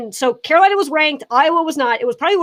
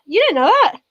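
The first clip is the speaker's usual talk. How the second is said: incredulous repeat; 6 semitones higher, faster than usual. Real, not acted. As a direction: push the disbelief harder the second time. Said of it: "repeat of the same question".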